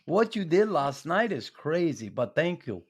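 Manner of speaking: imitated Swedish-accented English. Swedish teammate thanking Knuckles after last night, Swedish accent. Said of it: "didn't lose his accent".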